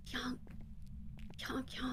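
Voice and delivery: barely voiced yonk, yonk; whispered yonk. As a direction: breathe the yonks out in a whisper. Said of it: "I'm pretty sure I whispered".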